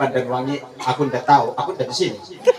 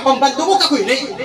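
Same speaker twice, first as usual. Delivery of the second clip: the wife snapping back, pitched high; sassy wife retort; wife talking back to her husband, mock snappy; 10 semitones higher than usual.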